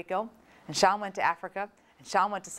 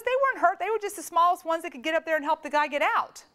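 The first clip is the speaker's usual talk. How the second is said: much higher, defensive reply; brother shrugging it off; 8 semitones higher, faster than usual. Retold or imitated. Brother defending the kids' rescue, high and defensive. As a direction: brush off the worry. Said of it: "He goes".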